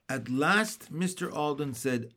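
ordinary delivery

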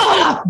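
short, high real yelp; sharp involuntary "ah"; startled yelp. Real, not acted. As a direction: cry out in sudden surprise. Startled by mishap while recording.

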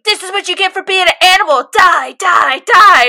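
high, loud, fast imitated rage; mock unhinged screaming, loudest on the last die; retold furious yell. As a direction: yell it like a crazed kid stabbing away. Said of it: "and yelling".

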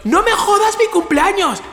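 high, drawn-out exasperated cry; slow high-pitched outburst; angry mock yell. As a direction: act out a desperate telling-off. Acts out yelling at friend.

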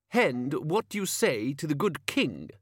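high, affected servant's voice; sharp-tongued page. A narrator's reading. Page demanding thanks from old man, condescending. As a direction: needle him as the king's snooty page.

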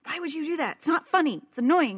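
hammed-up annoyance at herself; mock self-scolding. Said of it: "curse myself out".

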